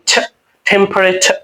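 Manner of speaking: ছ sound, then টেম্পারেচার ending in "chha"; native pronunciation. Shown as a demonstration. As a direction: finish টেম্পারেচার with an aspirated ছ. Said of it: "এখানে বলতে হবে কি?". (ছ দিয়ে টেম্পারেচার শেষ)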